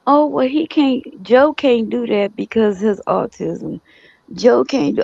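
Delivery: high whiny imitation of relatives; mocking family's excuses. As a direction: whine like they're making excuses. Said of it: "they were like".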